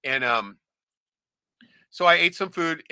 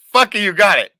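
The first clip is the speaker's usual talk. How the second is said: shrieking laugh then loud admission; 8 semitones higher, 11 dB louder than usual. Real, not acted. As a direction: burst out laughing at the right guess. Loud laugh at correct guess.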